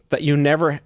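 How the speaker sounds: usual speaking voice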